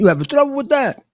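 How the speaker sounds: put-on high, quick Italian-accented challenge; Italian-accented question, pitched right up. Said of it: "in his Italian accent".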